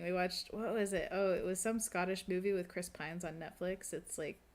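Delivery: normal talking voice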